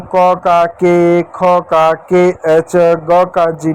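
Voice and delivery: क का के, ख का के एच spelled out slowly; drawn-out teacher recitation of the barakhadi; slow spelling drill. Shown as a demonstration. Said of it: "एक आपका जो बा खड़ी की मात्रा". (धीरे-धीरे मात्रा पढ़ाना)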